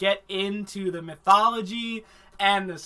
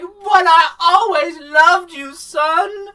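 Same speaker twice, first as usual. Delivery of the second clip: hammed-up weepy mother begging; mock crying plea; 7 semitones higher, 8 dB louder than usual. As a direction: ham up a sobbing mother. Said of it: "she's like".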